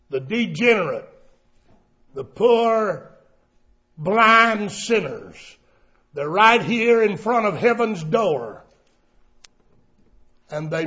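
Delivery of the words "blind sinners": ordinary delivery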